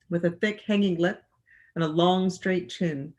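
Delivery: ordinary delivery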